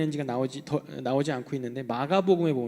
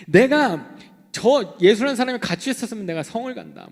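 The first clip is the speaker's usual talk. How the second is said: loud, higher swearing oath; mock angry oath; 6 semitones higher, 9 dB louder than usual. Preacher voicing Peter swearing an oath, loud mock anger. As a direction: swear it hot, hammed-up. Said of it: "저주하고 맹세하는 거예요".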